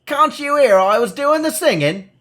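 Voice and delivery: put-on high, loud cranky old hermit voice; an irritated recluse scolding the intruder; crabby hermit. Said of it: "it demands crabbily".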